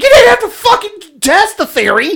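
high, loud disbelieving shout; incredulous yell. Retold or imitated. Scolding his friend Billy for the stunt, laughing shout. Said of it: "And I was like".

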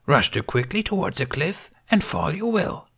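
put-on high, breathy little old master; lightly voiced Yoda. A narrator's reading. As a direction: croak it out like a tiny ancient sage. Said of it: "the Green Master said".

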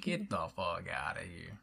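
slow, low northern drawl; northern accent. Shown as a demonstration. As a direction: say it the Northern way, slowly. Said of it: "Or when I say".

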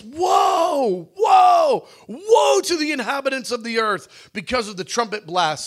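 high, loud ringing woe; loud angelic cry. Acted. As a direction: proclaim it like an angel crying out. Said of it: "cry out in a loud voice".

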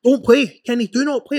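emphatic, insistent warning; urgent pleading advice. Retold or imitated. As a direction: imitate mates insisting he sit out. Acts out teammates urging him not to play.

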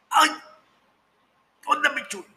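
high, wheedling pothead friend; sly friend voice. Retold or imitated. Friend Piñón offering the joint, sly high coaxing voice. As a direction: coax him to try it, voice up high.

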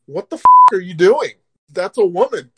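loud furious yell right in his face; angry shout. Retold or imitated. Confronting men grabbing friend's wife.

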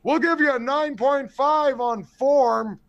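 high, slow mock judge's score; mocking judges. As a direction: hold up the scorecard, teasing. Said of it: "they're like".

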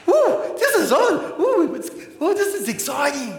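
high-pitched, giddy whooping, settling slightly by the end; excited Peter. Acted. Preacher acting out Peter at the transfiguration, thrilled and excited. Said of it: "And he's all excited".

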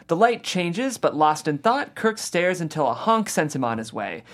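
normal talking voice

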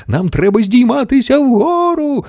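high, urgent storybook yell; insistent high-pitched cry; screaming back. A narrator's reading. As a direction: shout it up high over the roaring wind. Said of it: "верещав у відповідь містер Вонка".

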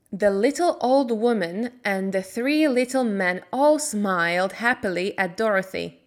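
ordinary delivery

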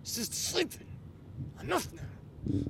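slow, soft teeth-gritted warning; through clenched teeth. Retold or imitated. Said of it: "through clenched teeth".

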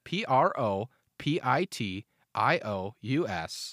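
P R O P I T I O U S spelled slowly, one letter at a time; spelling it out. Shown as a demonstration. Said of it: "What is it?".

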